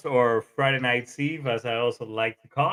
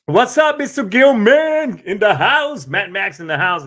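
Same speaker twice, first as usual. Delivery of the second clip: loud, high hype greeting; excited shout-out; 7 semitones higher, 8 dB louder than usual. Real, not acted. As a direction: hype up the guest loudly.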